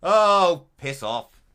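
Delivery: loud startled yelp; real startled loud yelp; startled yell. Real, not acted. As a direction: cry out at a sudden scare. Jump scare in game.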